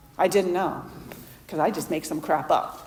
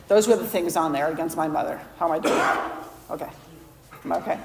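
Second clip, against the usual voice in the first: ordinary talk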